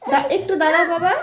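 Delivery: laughing as she speaks; words broken by a laugh. Real, not acted. Mother soothing toddler while laughing.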